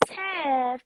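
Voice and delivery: imitating a friend's sugary baby voice. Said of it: "she put the baby voice on".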